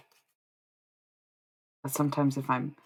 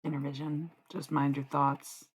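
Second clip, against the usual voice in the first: ordinary talk, nothing marked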